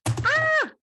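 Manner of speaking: short high-pitched shriek; quick squeal of alarm; startled yelp. Real, not acted. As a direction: let out a sudden, loud "ah!". Startled while handling the rune.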